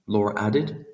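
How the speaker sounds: ordinary delivery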